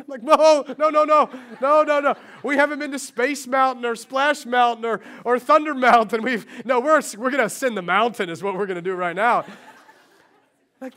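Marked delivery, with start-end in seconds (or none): 0.2-9.4 loud, high string of no's; hammed-up shouting down the idea; mock outraged insistence, half laughing; emphatic refusal. Pastor refusing to leave Disney.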